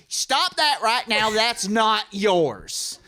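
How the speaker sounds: high, loud and slow holler; imitating an indignant heckler; heckler's protest. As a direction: scold from across the room, stretching the words. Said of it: "and he goes".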